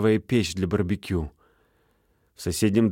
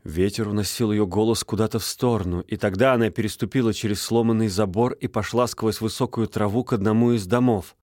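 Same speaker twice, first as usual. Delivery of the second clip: plain reading voice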